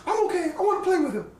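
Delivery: put-on high, pleading child voice; little boy. Small son asking to go back inside, high child voice. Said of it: "Marky says".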